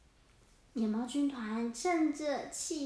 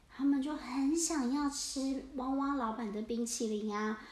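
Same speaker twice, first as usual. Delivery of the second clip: ordinary delivery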